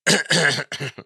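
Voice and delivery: sharp, loud cough into the mic. Shown as a demonstration. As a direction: cough hard and close. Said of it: "it's gonna be like".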